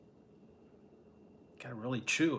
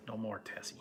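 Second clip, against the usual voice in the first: unvoiced whisper, No more, Tessie; whispering to the cat; 3 semitones lower, 10 dB quieter than usual. Real, not acted. Whispering to the cat Tessie to stop, hushed. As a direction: hush the pet without disturbing the call. Hushing the cat while on phone.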